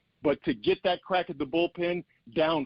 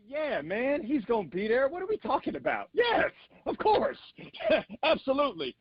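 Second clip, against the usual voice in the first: excited shout; 9 semitones higher than usual. Pretend. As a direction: burst out with the answer like a winner. Contestant answers, builds to shout.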